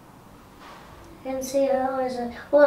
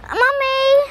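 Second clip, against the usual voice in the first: startled cry; 10 semitones higher, 4 dB louder than usual. Real, not acted. Child startled, calls out.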